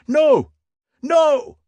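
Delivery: very high, loud desperate cry; shouted. A narrator's reading. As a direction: scream it after the deserter. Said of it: "shouted the Stubbs".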